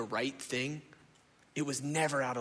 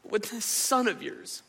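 louder, aggrieved complaint to his father; sulking son airing his grievance; resentful older son; 4 semitones higher, 5 dB louder than usual. Retold or imitated. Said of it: "He says".

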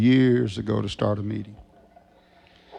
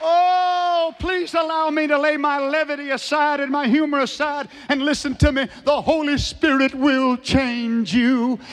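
very high, impassioned pulpit plea; full-force preaching. Real, not acted. Preacher laying humor aside to exhort listeners, full-force preaching. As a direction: cry it out, drawing out each key phrase.

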